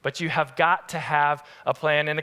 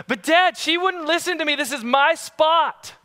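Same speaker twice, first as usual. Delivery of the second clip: put-on whiny child protest; 11 semitones higher, 4 dB louder than usual. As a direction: play the boy insisting it's his spot. Son protesting to dad.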